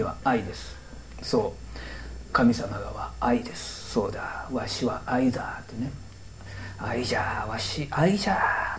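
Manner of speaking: put-on whispered old god voice; creaky wise old man. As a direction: breathe it out in a whisper. God voiced musing about love.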